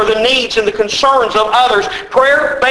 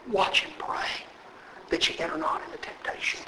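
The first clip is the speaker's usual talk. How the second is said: hushed scripture; 3 semitones lower, 16 dB quieter than usual. Acted. Hushed scripture after a warning.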